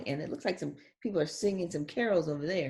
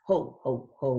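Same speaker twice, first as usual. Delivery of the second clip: lower, deeper-set jolly ho ho ho; Santa ho ho ho; 6 semitones lower, 3 dB louder, slower than usual. Acted. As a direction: boom it like Santa. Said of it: "she's saying".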